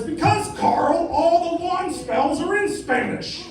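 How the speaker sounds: high, slow, patient put-on voice; Randy explaining. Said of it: "And Randy says".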